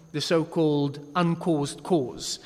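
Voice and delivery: ordinary talk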